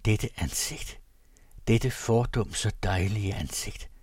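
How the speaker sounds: usual speaking voice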